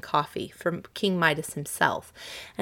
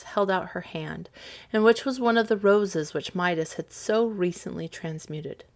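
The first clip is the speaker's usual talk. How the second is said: ordinary delivery, nothing marked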